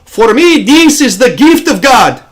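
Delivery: put-on high, slow, emphatic Egyptian accent. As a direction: imitate an Egyptian coworker proclaiming in heavy accent. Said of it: "I wanna do it in his accent".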